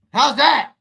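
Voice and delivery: loud high pumped-up shout; hyped shout. Real, not acted. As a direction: call it out still buzzing from the jolt. Hyped after smelling salt.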